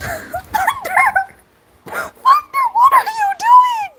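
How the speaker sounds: very high, incredulous squeal; squealed disbelief. Real, not acted. Streamer catching Thunder bullying Red, squealed disbelief. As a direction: squeal the name, then the question, aghast.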